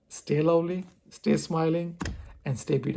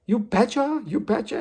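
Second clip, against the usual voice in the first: raised, higher coworker's voice; imitated big tone; 6 semitones higher, 3 dB louder than usual. Imitating the coworker who keeps saying it, big tone. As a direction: mimic the coworker's big, high delivery. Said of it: "and she has a big tone".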